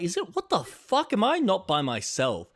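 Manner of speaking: high-pitched panicked squeal; freaked out. Retold or imitated. Alone at the store and hearing rustling, a panicked high squeal. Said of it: "And I freak out".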